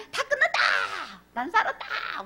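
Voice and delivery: high, jubilant exclamation; hammed-up victory shout; triumphant cry. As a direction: celebrate being saved. Mock triumphant relief.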